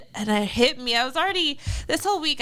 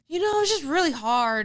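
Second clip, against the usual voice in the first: imitated child's lament, pitched up; sad little boy; 6 semitones higher than usual. Son confiding in mom in the car, sad little-boy voice. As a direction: play a young son feeling sorry for himself. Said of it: "looking really sad".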